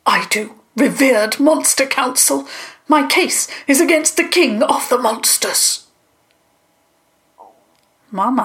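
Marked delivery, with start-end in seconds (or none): 0.0-5.8 raised-pitch formal address, mildly loud; speaking out loudly. A narrator's reading. Mister Sebastian brings his case to the council, speaking out. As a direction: project a plea to the court, without shouting. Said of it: "spoke loudly".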